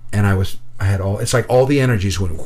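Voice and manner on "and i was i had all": plain reading voice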